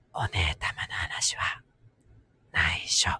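slow, hushed whisper; secretive whisper. Pretend. Keeping a secret, playfully.

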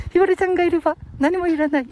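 high, quick puppet voice; small, high character voice; cheeky puppet sidekick. Pretend. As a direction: rattle it off as a high little dummy. Puppet and Grandpa on favourite singer.

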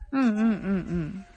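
ordinary delivery

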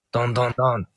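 spoken knock knock knock on a door; lightly voiced door knocking; mock knocking. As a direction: tap out the knocks with the voice.

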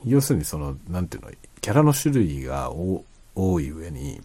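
ordinary talk, nothing marked

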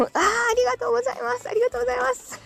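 high-pitched, gushing thanks. Pretend. Streamer thanking a friend for onion chips, high-pitched and gushing. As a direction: thank them in a very high, overjoyed voice.

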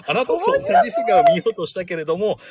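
high, hammed-up tearful line; melodramatic heroine. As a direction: overplay it like a tragic heroine. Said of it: "みたいな感じで". (芝居がかった悲劇のヒロイン)

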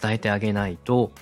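ordinary talk

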